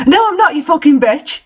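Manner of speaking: mock angry shout. A narrator's reading. Draco yells at the narrator, mock angry shout. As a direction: snap it like a parody of rage. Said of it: "he shouted angrily".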